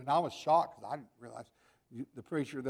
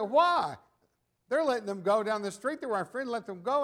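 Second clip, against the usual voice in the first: put-on whiny child; 8 semitones higher, 9 dB louder than usual. Children begging father for concert.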